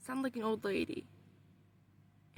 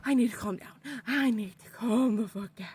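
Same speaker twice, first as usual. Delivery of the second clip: panicky self-talk; 6 dB louder than usual. Retold or imitated. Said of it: "I'm panicking in the dream".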